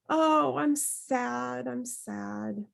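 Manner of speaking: slow, fading mock-sad moan. Shown as a demonstration. Teacher demos a sad voice for the class, slow and fading. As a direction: droop into a hammed-up sad sigh. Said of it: "lower emotions like sadness".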